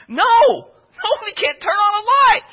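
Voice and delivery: very high, strained retort; mock exasperated squeal; exasperated refusal. Acted repeated refusal to panicking soldier.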